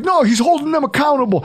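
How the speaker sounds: high-pitched mock Boston fan; mock Bostonian. Podcaster mimicking Brady's defenders, high mock Bostonian accent. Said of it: "do my worst Bostonian accent ever".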